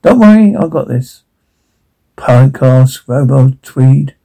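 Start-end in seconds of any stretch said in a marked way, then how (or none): 0.0-1.2 mocking imitation. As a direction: parrot it back mockingly. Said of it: "Cliff imitates mockingly".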